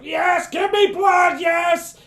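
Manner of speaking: high, drawn-out ecstatic shouting; frenzied cultist shout. Acted. Kneeling cultist begging as blood is poured, ecstatic shout.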